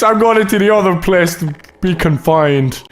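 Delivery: ordinary talk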